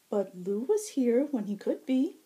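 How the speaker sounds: high, loud, playful fae tease; teasing high herald. Acted. Shinnah teasing the blushing Zack, high and playful. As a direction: tease him, lilting up high.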